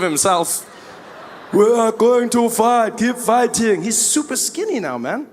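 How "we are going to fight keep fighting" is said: Malema imitation. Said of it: "the same voice".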